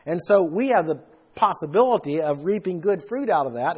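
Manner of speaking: ordinary talk, nothing marked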